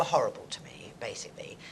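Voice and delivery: whispered confession. Real, not acted. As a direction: drop to a whisper. Confiding about bullies.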